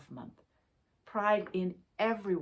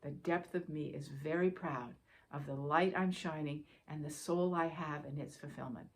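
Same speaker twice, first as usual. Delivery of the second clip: ordinary talk, nothing marked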